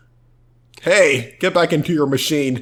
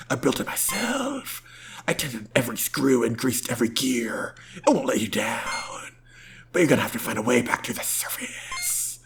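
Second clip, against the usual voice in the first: soft whispery character voice; 3 semitones lower, 7 dB quieter than usual. Acted.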